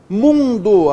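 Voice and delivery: "Mundo" loud, first syllable held; stressed nasal syllable. Shown as a demonstration. Teacher showing a nasal tonic, "Mundo" loud with long "Mun". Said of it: "a tônica é nasal".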